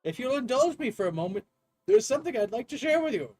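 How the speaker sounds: earnest, lecturing English professor; eager game professor. Acted. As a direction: share a dream in a lilting academic voice. Voices the game's professor.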